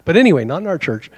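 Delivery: ordinary talk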